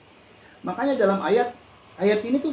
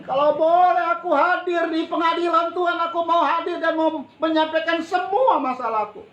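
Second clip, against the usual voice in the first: protesting Job; 6 semitones higher than usual. Retold or imitated. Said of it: "sampai dia berontak sama Tuhan".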